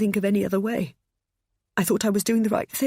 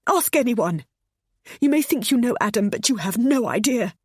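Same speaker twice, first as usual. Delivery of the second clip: high, loud sobbing plea; mother sobbing out her reasons; 8 semitones higher, 4 dB louder than usual. A narrator's reading. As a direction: sob through it. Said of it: "she sobbed".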